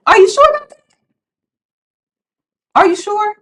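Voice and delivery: high, loud skeptical challenge; mock disbelief; sassy doubt. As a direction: raise the pitch and dare them to believe it.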